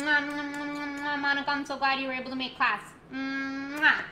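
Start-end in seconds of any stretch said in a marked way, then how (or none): none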